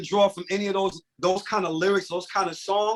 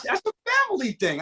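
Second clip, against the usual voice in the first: mock high exclaim; 13 semitones higher than usual. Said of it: "I'm like".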